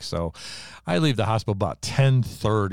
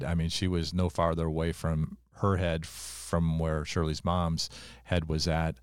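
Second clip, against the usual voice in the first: usual speaking voice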